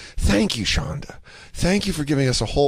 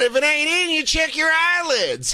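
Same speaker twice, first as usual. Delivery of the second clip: hack comic punchline; 13 semitones higher than usual. Retold or imitated. Comic delivering a crude one-liner, hammed-up. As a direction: drag out the dumb line in a silly high voice. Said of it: "I said".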